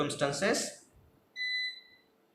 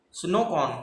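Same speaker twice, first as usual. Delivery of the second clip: usual speaking voice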